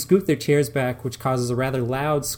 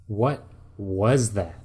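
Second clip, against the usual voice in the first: slow, haughty question; superior tone; 2 semitones lower, much slower than usual. Acted. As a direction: look down your nose at it. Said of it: "with a tone of superiority".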